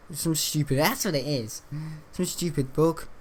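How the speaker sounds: ordinary talk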